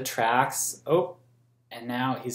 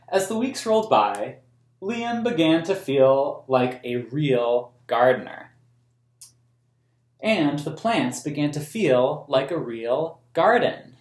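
put-on reading a picture book aloud to children; storybook reading for kids; story narration; 2 semitones higher, 4 dB louder than usual. A narrator's reading. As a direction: tell the story in a clear, even read-aloud voice. Reads the book's text aloud.

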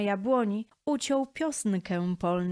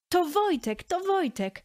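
high-pitched excited shout from the crowd; sudden call; 8 semitones higher than usual. A narrator's reading. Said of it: "ktoś nagle zawołał". (nagły, wysoki okrzyk z tłumu)

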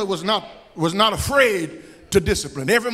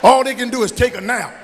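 preaching build; 5 semitones higher than usual. Acted. Pastor telling the church about discipline, preaching. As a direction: build the point to the crowd.

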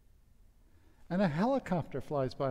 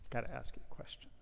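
whisper; 8 dB quieter, faster than usual. Real, not acted. Aside to the couple.

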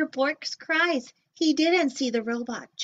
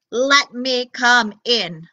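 robot demand; 9 dB louder, slower than usual. Acted. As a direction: growl the demand slowly.

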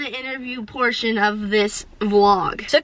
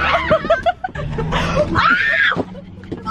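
shrieking laugh; 13 semitones higher than usual. Real, not acted. Bursts out at friend's remark.

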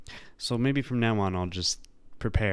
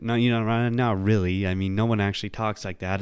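normal talking voice

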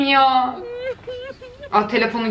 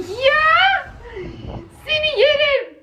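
much higher squealing cry of delight; cute squeal; 14 semitones higher, much slower than usual. Real, not acted. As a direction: squeal like it's too cute to bear.

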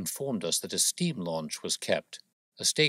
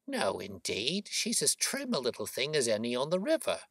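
high working woman's voice; proud boatman's wife; 7 semitones higher than usual. Acted. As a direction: boast of the boat, pitched high. Mrs Smith defends her launch.

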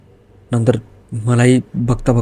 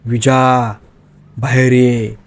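possessed wife calling; much slower than usual. Acted. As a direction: call him out like a ghost that wants his life. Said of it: "आणि मला म्हणली".

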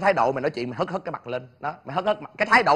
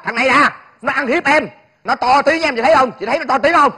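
high, loud aggrieved complaint; indignant tattling; 8 semitones higher, 6 dB louder than usual. Acted. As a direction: plead your case loudly to an elder. Complains to elder about the friend. (mách tội ấm ức, giọng cao)

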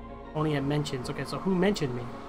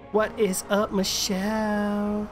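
slow, stretched, higher hello; drawn-out singsong greeting; 4 semitones higher, much slower than usual. Pretend. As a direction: stretch the name out playfully.

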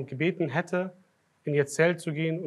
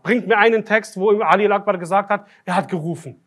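higher, louder, demanding voice of the sheikh; mocking the sheikh; 4 semitones higher, 9 dB louder than usual. Preacher mimicking the sheikh's loud demand to his audience, mock loud call. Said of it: "Dann sagt er auch".

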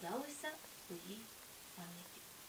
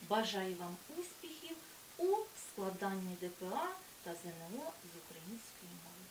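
ordinary talk, nothing marked

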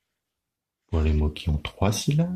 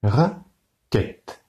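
ordinary talk

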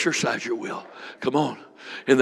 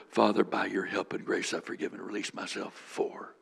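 soft prayer formula; 4 semitones lower, 10 dB quieter than usual. Shown as a demonstration. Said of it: "you go".

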